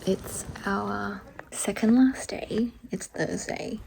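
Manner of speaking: soft whispered vlog voice; whispering. Real, not acted. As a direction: whisper it to the camera.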